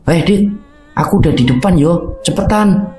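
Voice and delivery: put-on high-pitched young hiker's call; Putri calling ahead. A narrator's reading. Putri calls Dodit from up the trail, high calling voice. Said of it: "terdengar suara Putri dari arah depan".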